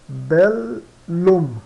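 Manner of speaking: "bellum" broken into bel, pause, lum; separated double L. Shown as a demonstration. Teacher showing how the word sounds, syllables held apart. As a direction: slowly split "bellum" between its two Ls. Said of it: "ou seja, soa".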